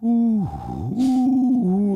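slow, low woozy voice; mock drugged. Host telling co-host how the painkiller hit, woozy and slow. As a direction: drag the words out as if the drug just kicked in. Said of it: "the drug hits me".

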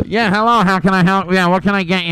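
drive-thru worker voice. Acted.

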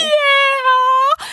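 high, loud teary little yeah; tearful toddler. Retold or imitated. Mom imitating her crying daughter answering. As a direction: answer through tears like a toddler. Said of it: "through tears, she's like".

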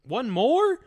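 high, loud rising echo; startled double-check; incredulous question. Real, not acted. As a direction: question it in disbelief, pitch shooting up. Mock disbelief at one more drink.

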